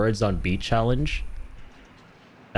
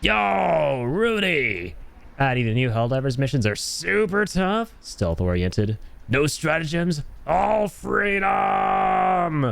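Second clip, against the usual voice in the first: high, drawn-out rallying finish; hyped shout-out; 9 semitones higher, slower than usual. Acted. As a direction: read it like a gung-ho hype call. Reading viewer's hype message aloud.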